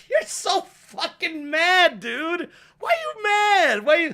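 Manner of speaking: high mocking taunt at someone raging. Viewer mocks a raging streamer, high and teasing. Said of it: "it's like".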